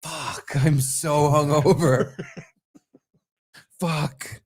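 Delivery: imitating weary dread, slow; unthrilled. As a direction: drag it out in mock dismay. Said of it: "I wasn't thrilled and happy".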